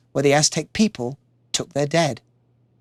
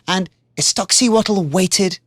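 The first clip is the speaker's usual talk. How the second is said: usual speaking voice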